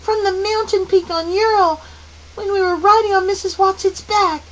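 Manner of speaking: put-on high, loud child's outcry; loud, high-pitched alarm throughout; crying out. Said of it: "Charles Wallace cried".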